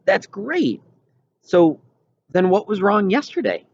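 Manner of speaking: put-on a curious kid friend pressing for news; eager friend Wendell. A narrator's reading. Wendell's reply voiced.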